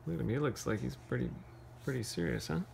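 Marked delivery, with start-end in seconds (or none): none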